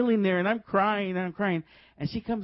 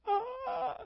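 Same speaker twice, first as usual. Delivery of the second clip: high, soft drawn-out "ah"; mock awed gasp; 7 dB quieter than usual. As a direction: imitate a stunned, breathy reaction. Mimics own awed reaction.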